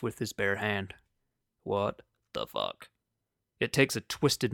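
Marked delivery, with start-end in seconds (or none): 1.6-2.9 much lower, slow incredulous voice; deep slowed voice. Pretend. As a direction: drop the voice way down, slowly.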